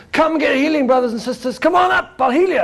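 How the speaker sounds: hammed-up healing preacher; preacher calling. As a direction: summon the crowd to be healed.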